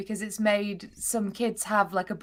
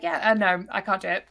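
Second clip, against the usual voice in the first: ordinary talk, nothing marked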